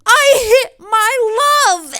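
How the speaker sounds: slow, high, loud anguished wail; freaking out. Retold or imitated. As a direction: wail in comic horror. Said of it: "and just freaks out".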